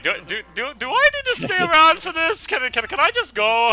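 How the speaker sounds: high, nervous stammer; awkward high voice. Retold or imitated. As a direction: stammer out like you want to leave. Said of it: "Varus like".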